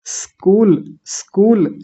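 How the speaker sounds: स्कूल with a light hissed s, then kool; correct स्कूल. Shown as a demonstration. Teacher demonstrating for students, स्कूल from a pure s. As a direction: lead into स्कूल on bare s, no i. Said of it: "ऐसा करके बोलना है".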